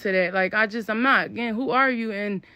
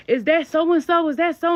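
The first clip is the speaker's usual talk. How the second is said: higher, louder eager shouts; excited calling out; 6 semitones higher, 4 dB louder than usual. Retold or imitated. Girls running up calling out excitedly. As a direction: call out excitedly to a friend. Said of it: "They like".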